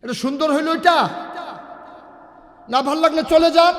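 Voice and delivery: loud, high-pitched telling-off; real exasperated scolding. Real, not acted. Scolds the disrupters, sends them off.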